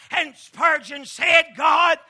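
loud rhythmic sermon cadence; preaching at full force. Acted. Preacher rousing his church about strength, full-force whooping delivery. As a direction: pound out each line to the congregation.

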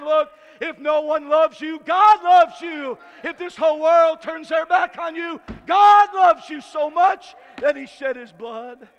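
loud, slow fire-and-brimstone preaching; preaching at full force. Acted. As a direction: shout it out to the congregation, full conviction. Preacher shouting at the cross.

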